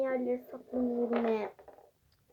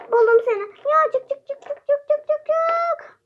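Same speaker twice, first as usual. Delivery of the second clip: excited high calling; 12 semitones higher, 9 dB louder than usual. Pretend. Seeker finds hider, calls out.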